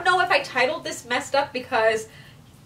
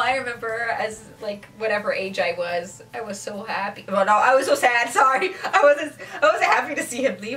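ordinary talk

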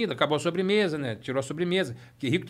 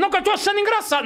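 mock mom scolding; 13 semitones higher, 5 dB louder, faster than usual. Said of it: "Aí ela falou".

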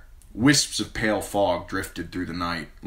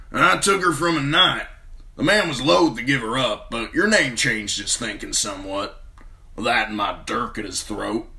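Bronn voice; 5 semitones higher, 4 dB louder than usual. A narrator's reading.

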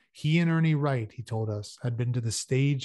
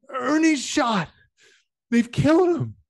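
hoarse attempted shout; 13 semitones higher, 7 dB louder than usual. A narrator's reading. Frank Torrey shouting breathless news across the room, hoarse. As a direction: force a shout through a failing hoarse voice. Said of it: "his voice was a hoarse whisper".

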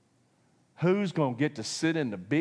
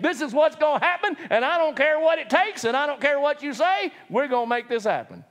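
raised, loud and dead set on getting their way; stubborn insistence; 11 semitones higher, 4 dB louder than usual. Retold or imitated. Preacher mimicking a stubborn believer, loud and insistent. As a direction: insist you'll have it no matter what. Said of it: "we're just dead set".

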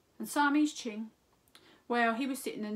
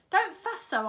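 higher, louder brush-off; dismissive; 10 semitones higher, 9 dB louder than usual. A narrator's reading. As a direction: wave off the worry. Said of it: "looking very determined".

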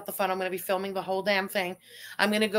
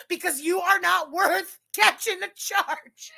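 laughing; 9 semitones higher, slower than usual. Real, not acted. Cracking up at own joke.

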